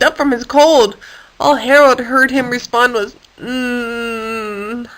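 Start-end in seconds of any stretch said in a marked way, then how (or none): 3.4-4.9 a soft, stuffed-up hum; stuffy hum. Acted. Ned the sick nuthatch answering Harold, muffled hum. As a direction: hum back, barely hearing. Bird with plugged ears responds.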